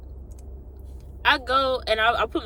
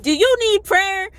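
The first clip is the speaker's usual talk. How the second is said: loud, high, drawn-out question; pushy, raised-voice offer; blurted offer; 10 semitones higher, 9 dB louder than usual. Retold or imitated. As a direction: imitate blurting it out too forcefully.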